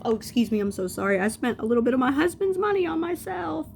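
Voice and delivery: loud, rising high-pitched self-correction; mock apology. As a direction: sarcastically overdo the correction, climbing in pitch. Mock apology, corrects to husband's money.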